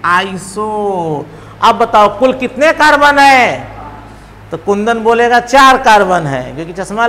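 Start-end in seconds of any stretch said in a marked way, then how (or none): 0.1-1.2 drawn out. Shown as a demonstration. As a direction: lay it out slowly again. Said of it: "नाम के पहले".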